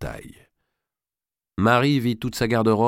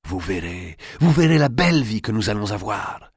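elated promise. Acted. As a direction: promise a happy future with growing fervour. Lover reassures Marie, elated by secret.

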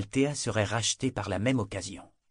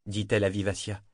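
ordinary delivery, nothing marked

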